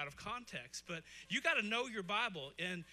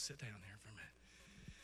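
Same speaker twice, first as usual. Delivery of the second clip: ordinary talk, nothing marked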